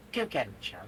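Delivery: low, half-sultry murmur. Acted. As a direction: purr it low, almost sultry. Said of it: "it's not necessarily a sultry voice".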